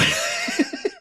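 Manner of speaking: very high laugh; real high-pitched laughter. Real, not acted. Host laughs at own line.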